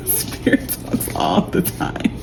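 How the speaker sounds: soft slow whisper broken by laughter; laughing whisper. Acted. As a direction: giggle it out under your breath. Laughing repeat of phrase.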